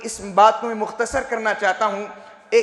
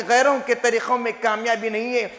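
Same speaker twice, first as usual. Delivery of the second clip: ordinary talk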